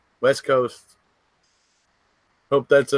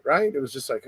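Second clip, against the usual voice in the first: usual speaking voice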